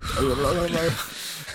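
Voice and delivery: long laugh. Real, not acted. Co-host laughs at radio story.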